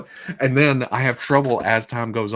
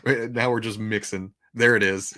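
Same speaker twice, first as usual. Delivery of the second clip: normal talking voice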